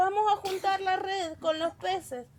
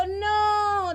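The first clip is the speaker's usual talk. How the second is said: raised, drawn-out call back to shore; acted fisherman's yell; shouted answer; 4 semitones higher, 4 dB louder than usual. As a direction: call back higher, stretching the word. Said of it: "Estaban gritando".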